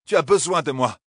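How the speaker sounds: loud, forceful angry assertion; furious insistence. A narrator's reading. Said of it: "une lueur furieuse passa". (affirmation furieuse, voix forte)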